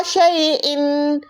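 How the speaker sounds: शैइन stretched slowly with a clear इन; slow recitation. Shown as a demonstration. Teacher recites शैइन for the class, slowly. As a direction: draw the word out. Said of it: "कहीं और जगह पे आपने देखा होगा".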